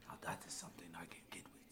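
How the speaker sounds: fast, quiet whisper. Acted. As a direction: whisper it conspiratorially. Conspiratorial line in skit.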